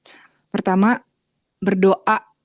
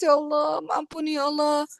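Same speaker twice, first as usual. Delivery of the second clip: higher, mock-weeping cry to God; imitated tearful plea; 6 semitones higher than usual. Repentant sinner begging Allah for forgiveness, imitated crying. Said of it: "nangis-nangis".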